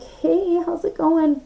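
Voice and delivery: high-pitched mock-friendly greeting; imitating an ex, pitched well up; ex sliding back in. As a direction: put on a sing-song casual hello. Mimics an ex coming back.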